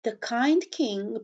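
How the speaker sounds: ordinary delivery, nothing marked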